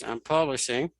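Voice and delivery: ordinary delivery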